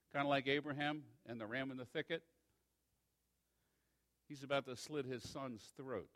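ordinary talk

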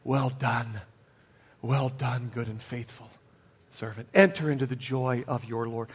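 low, soft, hushed welcome into glory; tender welcome. Retold or imitated. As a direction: breathe it like a loving blessing. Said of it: "to be welcomed into glory".

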